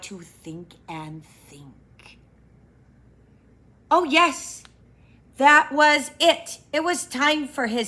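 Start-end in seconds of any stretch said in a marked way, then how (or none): 0.0-2.2 hushed whisper, slow. A narrator's reading. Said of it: "thinking hard, whispered". 3.9-6.6 sudden realisation. Acted. Pooh remembers suddenly.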